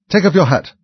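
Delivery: loud, high-pitched command; royal order. A narrator's reading. King to the Hatter in court, loud order. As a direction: order it sharply from the throne.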